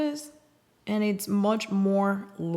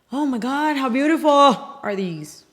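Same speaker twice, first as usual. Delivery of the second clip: real excited outburst over something beautiful; excited scream; 4 semitones higher, 9 dB louder than usual. Real, not acted. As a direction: gush at full volume, loud and high. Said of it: "I just screamed in your ear".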